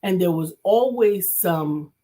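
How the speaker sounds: ordinary delivery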